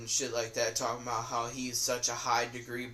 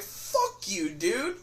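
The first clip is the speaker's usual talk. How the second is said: "Fuck you, dude" in a mild mock retort, only a bit higher; first take of the comeback, held back; 7 semitones higher, much slower than usual. Retelling his first comeback to Kyler, mild mock retort. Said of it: "my first instinct was just like".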